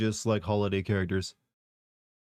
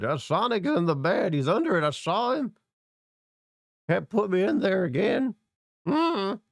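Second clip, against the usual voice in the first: put-on much higher, confused old man; 9 semitones higher than usual. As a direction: mumble like a befuddled grandpa. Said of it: "My old man voice has gotten really good".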